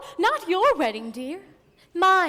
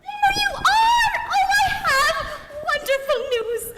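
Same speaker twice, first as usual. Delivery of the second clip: excited cry. Acted. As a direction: burst in laughing with delight. Chaperone bursts in with news.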